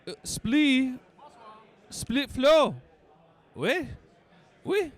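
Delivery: high, hammed-up French accent; mock French. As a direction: toss out French words in a high, silly French voice. Playing at being French.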